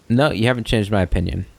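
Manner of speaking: ordinary talk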